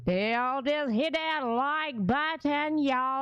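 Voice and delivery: slow, drawn-out Jamaican lilt; Jamaican accent. Retold or imitated. Imitating a Jamaican accent for viewers. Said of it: "She did the Jamaica version".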